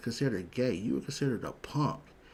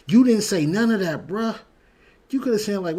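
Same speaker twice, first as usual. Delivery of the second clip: higher, louder, fed up; loud exasperated; 9 semitones higher, 11 dB louder than usual. Real, not acted. Telling Buck what he should have said, loud and exasperated. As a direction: throw it at him in disbelief. Scolding Buck over weak reply.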